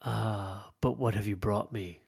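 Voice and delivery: low, quiet dragon-being voice; gravelly creature voice. Acted. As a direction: lean in and ask low. Dragon-being keeps speaking.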